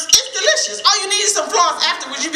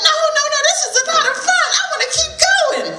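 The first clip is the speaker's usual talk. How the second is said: high, fast and laughing with delight; gleeful laughing rabbit; 12 semitones higher, much faster than usual. Acted. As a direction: giggle through it like a thrilled kid. Rabbit laughing on buzzard ride.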